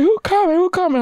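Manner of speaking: high-pitched, eager crowd voice; imitating excited crowd. Crowd outside wondering who's pulling up, excited.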